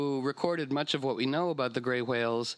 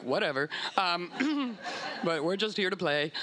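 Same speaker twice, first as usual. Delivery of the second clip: casual whale voice; 5 semitones higher than usual. Pretend. Whale voiced, unbothered reply.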